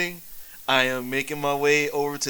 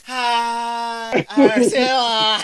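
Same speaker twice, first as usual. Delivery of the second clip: higher, louder slurred hello, drawn out; drunk greeting; 6 semitones higher, 7 dB louder, much slower than usual. Acted. Drunk hero sliding into the healer's arms. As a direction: greet her like a happy drunk.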